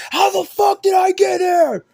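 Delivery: confused sleepwalker yelling on waking; furious shout. Retold or imitated. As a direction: bellow at the person who let you in. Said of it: "He's gonna wake up and be like".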